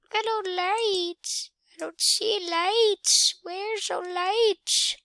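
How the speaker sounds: high, slow sing-song; drawn-out high-pitched plea; playful calling out. Real, not acted. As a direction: stretch it out high, as if calling for something missing. Calling for missing lights, sing-song.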